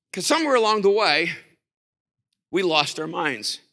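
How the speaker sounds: ordinary delivery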